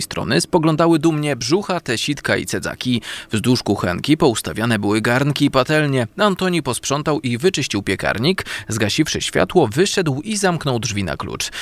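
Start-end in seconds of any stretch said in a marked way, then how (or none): none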